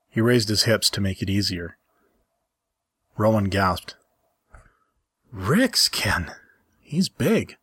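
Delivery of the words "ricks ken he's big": breathless whispered name, then a high astonished lift on big; surprised gasp. Acted. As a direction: gasp it out, caught off guard. Rowan gasps in surprise.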